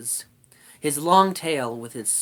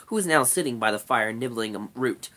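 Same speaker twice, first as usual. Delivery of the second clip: plain reading voice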